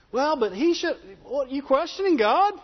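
lightly voiced whiny objector; a mild mock protest; complaining skeptic. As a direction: push back on God like a doubter. Skeptic questioning God's setup.

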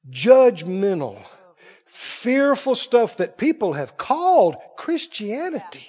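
ordinary delivery

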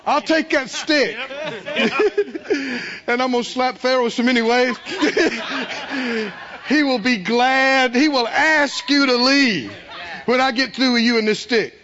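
God laughing. Retold or imitated. God gleefully promising Moses victory, laughing. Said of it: "He said".

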